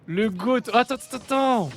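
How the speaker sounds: high, loud live-match outburst; excited caster calling out; excited exclamation. Real, not acted. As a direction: cry out, pitched well up.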